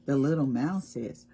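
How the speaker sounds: plain reading voice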